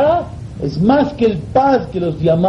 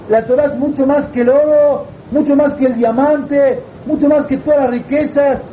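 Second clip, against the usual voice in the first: high, wailing plea; tearful protest; 7 semitones higher than usual. Acted. Yossi refuses to sell his Torah to anyone, high tearful protest. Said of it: "otra vez Yossi llorando".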